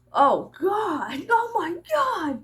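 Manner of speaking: rising to a very high slow wail; high, drawn-out panicked cry; mock dread. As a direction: play a child hit by fear of death. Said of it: "where they go".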